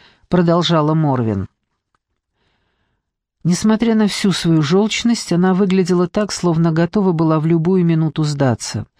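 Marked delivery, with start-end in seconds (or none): none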